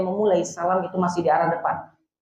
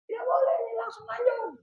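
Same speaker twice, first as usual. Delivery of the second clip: much higher, softer mocking voice, fast; mocking other school; 6 dB quieter, faster than usual. Teacher parodies a rival school's objection, high and fast. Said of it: "aliran yang sikoknya".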